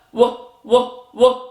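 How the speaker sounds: "w, w, w" as three short separate sounds; isolated letter sound. Shown as a demonstration. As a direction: repeat the opening sound "w" alone, with gaps. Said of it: "What letter sound are we".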